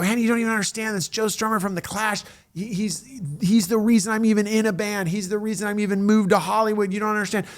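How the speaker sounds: high-pitched, breathless excitement; excited pleading. Retold or imitated. Young host pleads with his boss about [name], high and excited. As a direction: gush it, you can't contain yourself.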